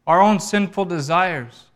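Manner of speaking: loud, high, forceful sermon delivery; ringing, emphatic pulpit proclamation; impassioned preaching. Real, not acted. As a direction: preach it with full conviction.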